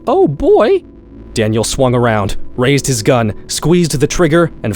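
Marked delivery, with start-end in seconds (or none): 0.1-0.8 very high, loud startled yelp; involuntary high-pitched. Acted. Daniel startled by a slam on the front door, high-pitched yelp. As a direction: squeak it out before you can stop it. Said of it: "involuntary high-pitched".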